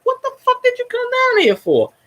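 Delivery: high, far-off put-on voice, outraged; mock outraged yell. To the Redskins fan, a high mock outraged yell. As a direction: squeal it like an indignant local. Mocking reply to hated fan.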